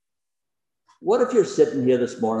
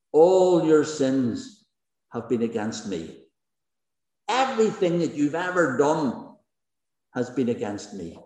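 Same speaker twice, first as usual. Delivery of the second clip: solemn divine claim. Acted. Preacher voices Jesus' claim.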